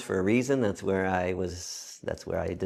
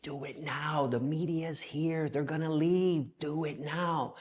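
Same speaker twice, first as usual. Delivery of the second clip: hushed, high, urgent plea; stage-whispered urging, drawn out; mouthed urging; 6 semitones higher, 8 dB quieter, slower than usual. Retold or imitated. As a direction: mouth it softly across a distance, slightly raised pitch. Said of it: "my friend Marie mouths to me".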